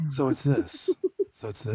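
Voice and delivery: low, very soft after a sigh; trying out a relaxed low register, quietly; natural sighed-out voice. Shown as a demonstration. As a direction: let the voice sink low on a released breath. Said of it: "that's the normal range of your voice".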